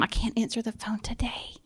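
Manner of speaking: a quiet weary aside; whispered excuse. Retold or imitated. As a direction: mutter the excuse under your breath. Imagined reluctant excuse.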